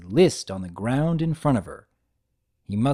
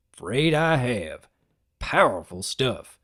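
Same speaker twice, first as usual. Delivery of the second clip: unhurried, lightly lowered stallion voice; lightly voiced farm stallion; slower than usual. Big Mac voiced, slow and low.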